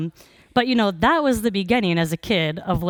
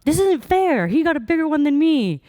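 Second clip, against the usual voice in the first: put-on much higher, louder childish whine; kid's freak-out; 10 semitones higher, 4 dB louder than usual. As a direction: wail it like a cheated little kid. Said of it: "the freak out".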